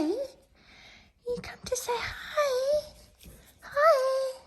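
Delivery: very high, slow, breathy squeak. Real, not acted. Squeals slowly to the puppy who came to say hi. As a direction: stretch it out in a tiny squeal.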